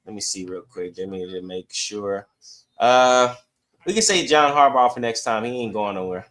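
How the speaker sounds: usual speaking voice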